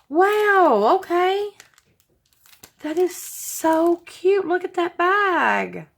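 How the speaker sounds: high, delighted gasping over the gift; excited squeal. Real, not acted. Vlogger opening a fan's birthday gift, squealing with delight. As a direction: pitch jumps way up with real glee. Thrilled unboxing a gift bag.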